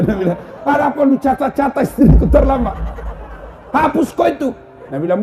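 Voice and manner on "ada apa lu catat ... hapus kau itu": high, loud angry order; furious demand. Retold or imitated. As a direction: order him to erase it. Husband furious at officer. (suami membentak petugas PLN, suara tinggi)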